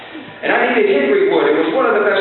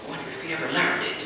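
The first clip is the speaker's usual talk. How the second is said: much softer murmur of a wife's aside; whisper; 12 dB quieter than usual. Retold or imitated. Woman whispering to her husband in the audience, soft murmur. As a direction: hush it as a private aside. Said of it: "whisper to her husband".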